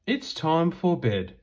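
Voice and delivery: usual speaking voice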